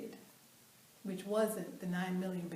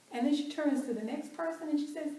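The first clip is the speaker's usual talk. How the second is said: ordinary delivery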